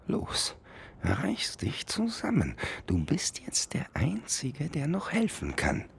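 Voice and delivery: high beetle voice, whispered; whispering beetle. Acted. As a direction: steel himself in a tiny whisper. Beetle encourages himself.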